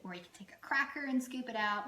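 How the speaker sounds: ordinary talk, nothing marked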